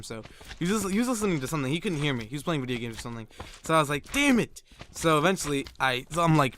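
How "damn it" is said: high exasperated outburst; retold frustration. His brother couldn't hear him, retold frustration. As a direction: act out a kid's high-pitched exasperation. Retells frustration at being unheard.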